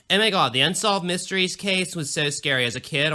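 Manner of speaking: bubbly Valley Girl imitation of herself; mock her own Valley Girl lilt; lightly done Valley Girl self-parody. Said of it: "I sound like a fucking Valley Girl".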